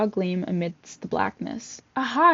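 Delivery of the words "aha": a higher, louder Aha of discovery; excited cry. Acted. Falling boy spotting a gleam, excited cry. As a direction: burst out with sudden hope. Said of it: "he cried".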